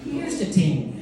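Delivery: put-on Irish lilt; Irish accent. Shown as a demonstration. Comedian showing a favorite Irish word, in an Irish accent. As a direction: show off an Irish accent on "ting". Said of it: "My favorite word in the Irish accent is ting".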